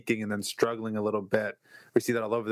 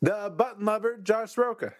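high-pitched ringmaster announcer; 11 semitones higher than usual. Acted. Introducing co-host.

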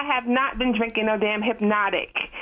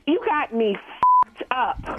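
angry; much slower than usual. Real, not acted. Mother angry at caller.

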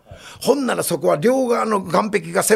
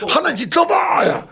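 panicked high shout; 6 semitones higher than usual. Retold or imitated. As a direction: shout while scrambling away. Panicked reporter after attack.

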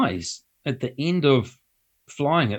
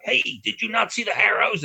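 mock driver call; 8 semitones higher than usual. Retelling the driver's call again.

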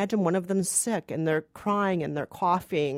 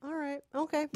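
grudging concession; 7 semitones higher, 8 dB quieter than usual. Real, not acted. Reluctant concession.

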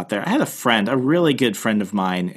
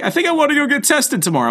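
much higher, fast fretful mock voice; imitating the friend's worry, higher and quick; faster than usual. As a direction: rush it up high like the worried friend. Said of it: "He's like".

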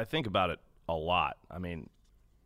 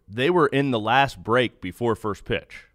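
normal talking voice

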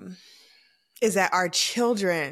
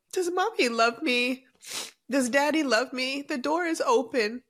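tearful; 5 semitones higher than usual. Real, not acted. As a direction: voice pitched up and breaking with tears.

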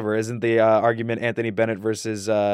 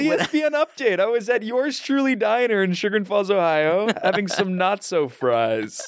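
excited retelling; 8 semitones higher than usual. Real, not acted. Excitedly relives hearing of the win.

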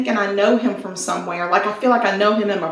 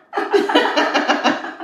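breathy laugh; 6 semitones higher than usual. Real, not acted. Laughing at wink joke.

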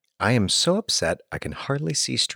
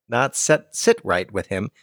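usual speaking voice

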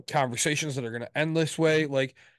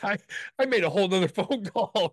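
laughing through the words, pitched up; real amusement breaking into the brag; 6 semitones higher, 3 dB louder than usual. Real, not acted. Laughs while telling it.

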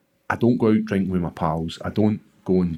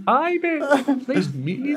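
very high, squeaky retold reply; mock politeness; slower than usual. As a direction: answer in an exaggerated falsetto. Mock reply to perky fighter.

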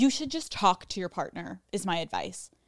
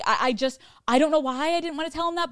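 ordinary talk, nothing marked

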